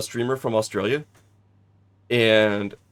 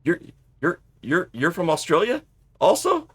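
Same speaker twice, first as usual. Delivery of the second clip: surprised question; 7 semitones higher than usual. Retold or imitated. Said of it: "he's all".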